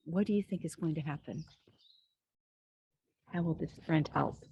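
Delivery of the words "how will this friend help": ordinary delivery, nothing marked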